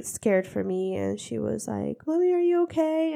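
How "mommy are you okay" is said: put-on child voice.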